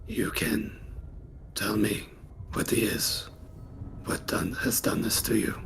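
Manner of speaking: low, whispery voice with long pauses; solemn hushed adventurer. Acted. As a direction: speak gravely in halting, hushed phrases.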